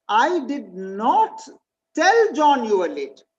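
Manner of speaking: slow start, "tell" pushed and falling; stress on TELL. Shown as a demonstration. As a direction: weigh the word "tell", as if only hinting. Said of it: "Means I might have implied it".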